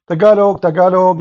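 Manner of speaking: high, loud excited call of "Tagalog, Tagalog"; street greeting call. Retold or imitated. People in the street greeting each other, calling out excitedly. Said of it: "you go in the street now".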